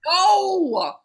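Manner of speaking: playful loud refusal; emphatic no. Real, not acted. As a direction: shut the question down with a yell. Reply to "do I wanna hear.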